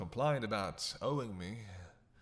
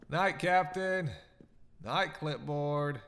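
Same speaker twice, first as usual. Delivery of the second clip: sing-song goodbye; 4 semitones higher, 5 dB louder, much slower than usual. Acted. Mocking goodnight to departing hunters.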